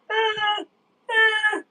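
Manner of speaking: high drawn-out "uh" noise; exaggerated high moaning sound; mock whine. Unimpressed by regular season games.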